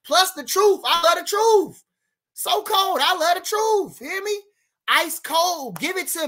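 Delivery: excited boast. Real, not acted. Boasting he loves the truth.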